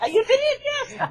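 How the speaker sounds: high laughing reply; amused, laughing agreement. Real, not acted. Laughing at the host.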